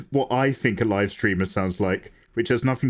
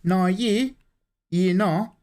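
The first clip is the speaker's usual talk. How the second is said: put-on high broad Kiwi accent; 6 semitones higher, slower than usual. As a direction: go full Kiwi. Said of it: "Ten out of ten Kiwi".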